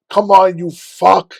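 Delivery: loud, high, drawn-out furious cursing; retold enraged yell at his own leg; angry screaming at himself. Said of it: "I was so angry".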